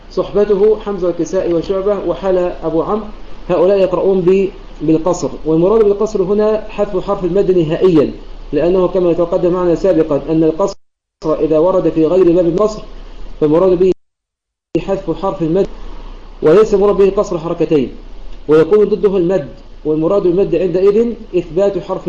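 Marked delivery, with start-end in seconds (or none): none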